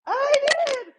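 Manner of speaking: high, thrilled squeal over the gift; overjoyed gift reveal; excited squeal. Real, not acted. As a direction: gush with delight, voice cracking up high. Excited gift unboxing on air.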